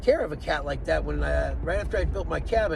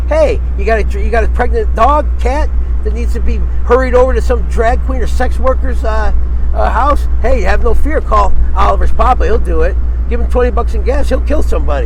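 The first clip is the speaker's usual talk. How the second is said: mock ad pitch; 5 semitones higher, 12 dB louder than usual. Mock ad for himself.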